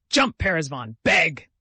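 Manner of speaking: loud barked commands to a dog; shouted commands. Acted. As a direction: call the dog to beg, loud and sharp. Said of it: "shouted Kolya".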